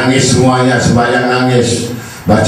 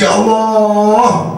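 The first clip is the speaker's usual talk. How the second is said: slow, drawn-out, higher ya Allah; mock lament; 7 semitones higher, much slower than usual. Preacher acts a stressed worshipper's slow lament. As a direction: sigh it out like a worried sinner.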